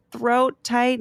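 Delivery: plain reading voice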